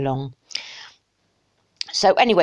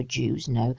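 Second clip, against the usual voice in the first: ordinary delivery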